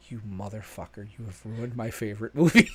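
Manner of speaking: hushed, seething accusation, building slightly; low-key retold outrage; mock quiet fury. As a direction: imitate an outraged wife, starting very softly. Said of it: "she literally turns around, she goes".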